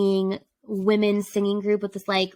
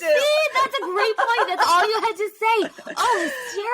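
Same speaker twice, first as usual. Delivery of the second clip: very high, giggly excitement; gushing enthusiasm; 11 semitones higher than usual. Real, not acted. Co-host raving about a hilarious comedian, gushing and high. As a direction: squeal it happily, laughing through the words.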